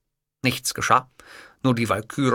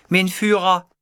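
high, louder secretary voice; 8 semitones higher, 6 dB louder, slower than usual. A narrator's reading. Secretary addressing her boss, high character voice. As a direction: add it pointedly as the secretary.

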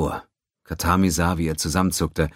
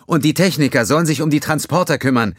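higher, louder, firm command; loud order; 11 semitones higher, 7 dB louder than usual. Acted. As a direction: relay the order across the bridge. Ensign calling out order.

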